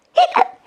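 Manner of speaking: squeaky high hiccup sound; cartoon hiccup. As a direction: pop a high little hiccup. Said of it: "Princess Daphne hiccuped".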